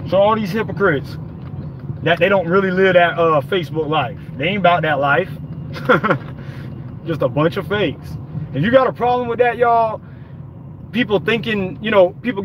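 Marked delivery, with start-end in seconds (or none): 5.3-7.0 soft breathy chuckle; short voiceless snicker; scornful chuckle. Real, not acted. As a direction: let out a quiet, dismissive little laugh. Laughs at the people he mocks.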